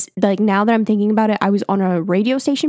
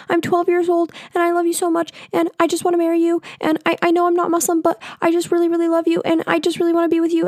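put-on much higher, rushed kid's voice; twelve-year-old fangirl; 10 semitones higher, faster than usual. Twelve-year-old on air begging the YouTuber to marry her, high and rushed. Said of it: "I'm literally like".

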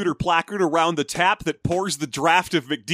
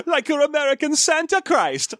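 put-on high-pitched comic German accent; hammed-up German Santa voice; 10 semitones higher than usual. German Santa explaining himself, high mock German accent. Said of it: "try out my German accent".

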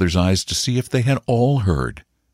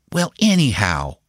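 put-on higher, sturdy little boy's voice; stout, boyish; 5 semitones higher than usual. A narrator's reading. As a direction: state it with childish firmness. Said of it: "he said stoutly".

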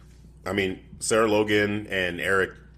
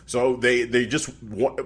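normal talking voice

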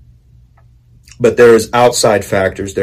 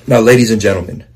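usual speaking voice

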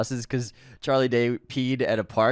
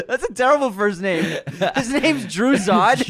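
laughing; 8 semitones higher than usual. Real, not acted. Laughing at villain's name.

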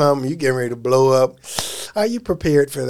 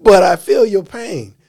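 high squeal of laughter into the words; laughing; 4 semitones higher, 5 dB louder than usual. Retold or imitated. Teasing Tom about his fame, laughing through it. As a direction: laugh through "I feel your pain". Repeats line cracking up.

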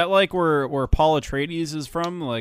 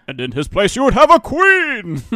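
builds to a high, loud proclamation; hammed-up booming prophecy; mock Galadriel speech; 7 semitones higher, 8 dB louder than usual. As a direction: declaim like a terrible fantasy queen.

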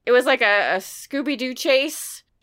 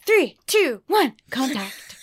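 countdown announcer; 3 semitones higher, slower than usual. Pretend. Host joking about the episode number, slow countdown. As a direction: count down like an old TV show. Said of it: "I really wanted to say".

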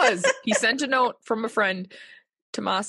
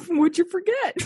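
cracking up mid-line; 8 semitones higher, 3 dB louder than usual. Real, not acted. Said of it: "say it again".

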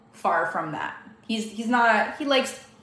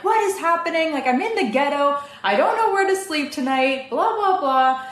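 retold screaming at phone support; 6 semitones higher than usual. Woman retells screaming at Airbnb on the phone, high frantic yell. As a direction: replay the panic of yelling at support. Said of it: "screaming at them".

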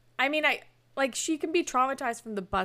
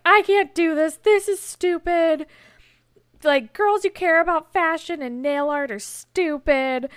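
loud, higher-pitched exasperated outburst; mock rant; 6 semitones higher, 7 dB louder than usual. She rants about doing forced nail art, exasperated. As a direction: imitate her fed-up rant, punched out loud. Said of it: "she goes on a whole rant".

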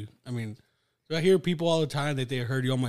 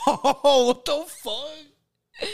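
mock outrage; 13 semitones higher, 7 dB louder, much slower than usual. Real, not acted. Teased by friend.

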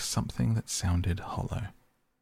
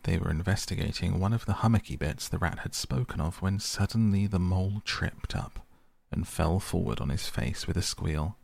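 plain reading voice

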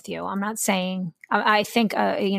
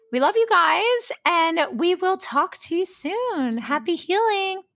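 high, bright singsong goodbye; high, slow singsong podcast goodbye; cheery sign-off; 10 semitones higher, slower than usual. Real, not acted. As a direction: sing the farewell up high.

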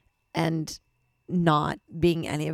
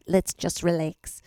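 badly faked Australian accent; slower than usual. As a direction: try an Australian accent, slow. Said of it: "she had an Australian".

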